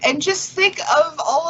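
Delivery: excited, pitch climbing high toward the end; delighted welcome, rising high. Real, not acted. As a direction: let real excitement lift the voice up and up. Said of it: "I am so excited".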